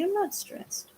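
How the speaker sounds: high and soft, telling the dog she's not stressed; high pet voice. Real, not acted. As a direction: coo it to reassure the puppy. Reassuring the dog.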